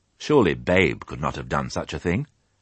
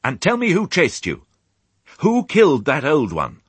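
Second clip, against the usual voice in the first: higher, louder impatient questioning; barking demand; 10 semitones higher, 5 dB louder than usual. A narrator's reading. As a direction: demand it like a stern sheepdog. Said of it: "she barked".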